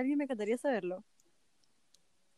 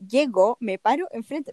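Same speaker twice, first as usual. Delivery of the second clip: usual speaking voice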